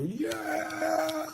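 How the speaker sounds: high, mock crowd cheer. Crowd on the yachts cheering her song, a high mock whoop. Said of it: "They go".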